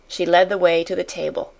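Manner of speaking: usual speaking voice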